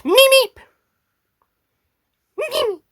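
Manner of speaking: very high creature squeal; Meep catchphrase. Acted. The Meep's catchphrase.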